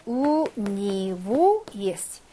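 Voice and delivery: 우니우 drawn out syllable by syllable; slow model pronunciation. Shown as a demonstration. Teacher giving "to him" in Russian, slow model pronunciation. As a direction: say 우니우 slowly, holding each vowel.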